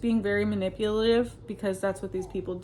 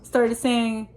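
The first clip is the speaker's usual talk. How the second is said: ordinary talk, nothing marked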